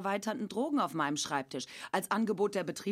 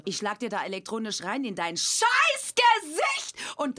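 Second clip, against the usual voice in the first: mock threat; 3 semitones higher, 7 dB louder, slower than usual. Mock threat in comic sketch.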